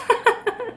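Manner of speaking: short high burst of laughter. Real, not acted. Laughing at how obvious her fear was, a high laugh. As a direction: let out a quick high laugh. Laughs after the story beat.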